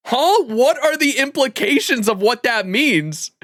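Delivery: high, loud, drawn-out outburst of confusion; re-enacted bafflement. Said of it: "I was like".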